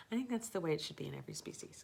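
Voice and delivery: fast conspiratorial whisper; a playful whispered joke; whispered aside. Real, not acted. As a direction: lean in and whisper it fast like a secret. Cheeky aside after laugh.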